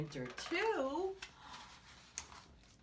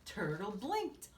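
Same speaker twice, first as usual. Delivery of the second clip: ordinary delivery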